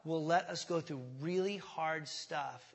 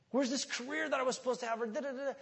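high, fast mock whine at God; whiny complaint to God; 8 semitones higher, faster than usual. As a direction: mimic a frustrated believer griping. Mock complaining believer again.

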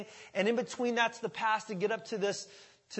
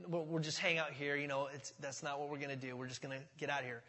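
softer, backing-down mumble; sheepish retreat; 5 semitones lower, 10 dB quieter, faster than usual. Acted. [name] imagining backing off, softer sheepish mumble. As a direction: slink away with your words.

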